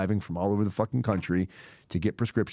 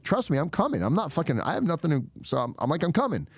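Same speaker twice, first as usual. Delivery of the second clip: higher-pitched, pleading insistence; insistent assurance; 7 semitones higher than usual. Retold or imitated. Suspect assures the agent on the phone, insistent. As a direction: insist you mean it, pitch lifted.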